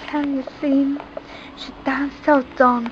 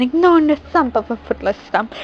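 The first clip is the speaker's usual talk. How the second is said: plain reading voice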